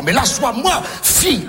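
mock yell. Bagarre jouée, cri à la fille.

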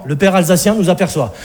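ordinary talk, nothing marked

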